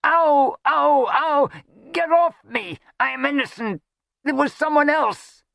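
very high yelps and protests; pained shouting. A narrator's reading. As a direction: cry out frantically for help. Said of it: "Thus shouting".

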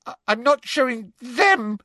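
high, stammered refusal; tearful, piercing objection; shrill. A narrator's reading. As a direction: protest shrilly. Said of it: "said Hermione shrilly".

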